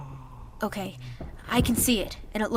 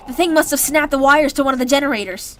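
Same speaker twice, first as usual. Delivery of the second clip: loud, higher urgent warning; alarmed shout; 6 semitones higher, 8 dB louder than usual. Acted. Fire breaking out.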